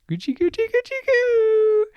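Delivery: very high, squeaky playful tickle noise; tickling wolf's goochie-goo. A narrator's reading. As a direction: squeal it out like a tickling game, slowing at the end. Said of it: "with paws outstretched went".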